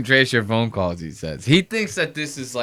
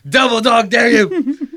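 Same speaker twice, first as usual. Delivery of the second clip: much higher, shouted parody; mocking mimicry; 11 semitones higher, 8 dB louder than usual. Host parroting the clip's threat, high shouted mockery. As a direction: squawk back the dare to ridicule him.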